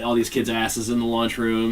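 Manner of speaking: ordinary talk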